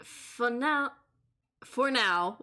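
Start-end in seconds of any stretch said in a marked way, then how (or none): 0.0-0.9 put-on lingering French accent, slipped in by mistake; a trace of the French accent. Judge's line slipping into the chef's French accent. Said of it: "I keep getting stuck into the French".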